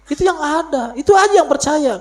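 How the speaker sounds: high emphatic. Real, not acted. High loud emphatic claim.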